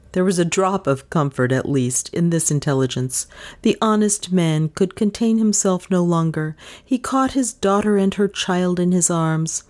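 plain reading voice

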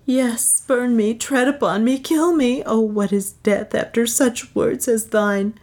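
high, anguished plea of innocence; heartfelt, high-pitched lament; distraught pleading. A narrator's reading. Beatrice desperately pleads her innocence.

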